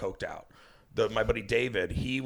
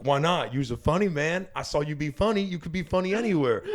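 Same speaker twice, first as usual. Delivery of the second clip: higher, louder retold pep talk; emphatic, raised-voice encouragement in a quote; insistent urging; 7 semitones higher, 6 dB louder than usual. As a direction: imitate someone insisting, voice climbing. Acts out Marshawn urging him on.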